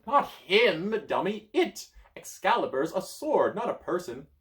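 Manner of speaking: high, loud put-down; scornful wizard. Acted. Merlin mocking the child, high and loud. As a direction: call them a dummy.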